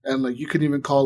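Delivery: ordinary talk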